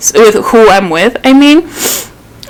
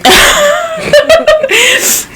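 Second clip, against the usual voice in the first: tearful laugh. Real, not acted. Friend says you'll make me cry.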